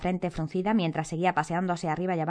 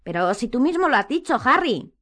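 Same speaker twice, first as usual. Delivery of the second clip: raised pitch, pressing hard; vehement insistence; 6 semitones higher, 4 dB louder than usual. A narrator's reading. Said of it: "insistió Hermione con vehemencia".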